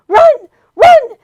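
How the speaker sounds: high loud urgent shout; urgent shouted warning; crying out. A narrator's reading. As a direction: yell a warning. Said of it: "it urged once more".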